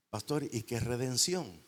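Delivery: ordinary talk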